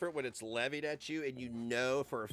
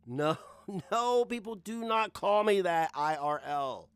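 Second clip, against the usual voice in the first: loud, high, drawn-out refusal; slow, forceful protest; emphatic denial; 4 semitones higher, 7 dB louder, slower than usual. Real, not acted. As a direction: insist loudly, stretching the words.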